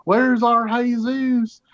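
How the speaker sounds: high, long-drawn searching call; old lady calling out. Retold or imitated. Little old lady on set searching for her actor, high drawn-out calling. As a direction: sing out the name as if hailing someone lost. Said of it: "this little old lady keeps on calling".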